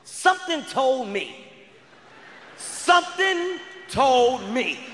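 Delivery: slow, weighty declaration with long pauses; drawn-out emphasis. Acted. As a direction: stretch each word out slowly. Dramatic repeated inner hunch.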